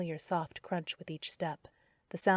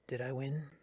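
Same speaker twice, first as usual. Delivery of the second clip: a hint of a lower, rougher man; low and softer, strangely calm; rough deep voice; 4 semitones lower, 6 dB quieter than usual. A narrator's reading. Darren asking Carrie about the contest, lightly lowered rough voice. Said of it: "Rough, gravelly, and much deeper".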